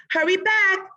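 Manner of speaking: high, loud, urgent hurry; urgent call. A narrator's reading. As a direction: call her back fast, worried. Missus Gilman urging Emma.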